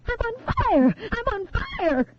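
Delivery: very high, piercing panic; screaming in terror. A narrator's reading. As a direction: scream as the flames reach you. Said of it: "the girl screamed".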